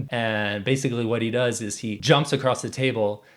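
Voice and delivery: ordinary talk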